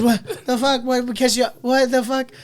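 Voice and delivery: high, loud baffled sputter, imitated; mock bewilderment. Boston man's retold baffled reaction.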